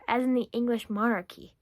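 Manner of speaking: normal talking voice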